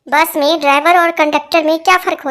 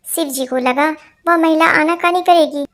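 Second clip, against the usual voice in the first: normal talking voice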